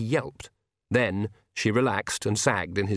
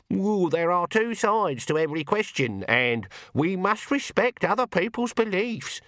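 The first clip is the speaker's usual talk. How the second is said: mock a mealy-mouthed believer; mocking prim voice; 8 semitones higher than usual. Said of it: "you wouldn't catch me saying things like".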